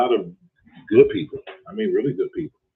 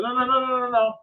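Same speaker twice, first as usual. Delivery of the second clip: pitched-up no no no, cutting him off; high protest; 11 semitones higher than usual. Retold or imitated. Refusing caller, high pitched.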